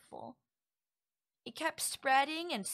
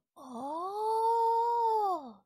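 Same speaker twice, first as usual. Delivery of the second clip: high, louder delighted oh oh; excited oh oh; 9 semitones higher, 8 dB louder than usual. Acted. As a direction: burst out with delight. Sudden excitement over ASMR.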